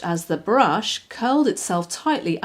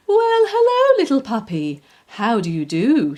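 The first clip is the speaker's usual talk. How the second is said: usual speaking voice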